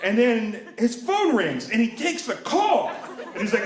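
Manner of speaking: ordinary talk, nothing marked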